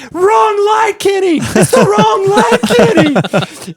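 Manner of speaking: high, loud shout across the track; yelling from the press box. Retold or imitated. Announcer yelling at a rider to look at the right light. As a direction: yell to catch his attention. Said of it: "just yelling at him".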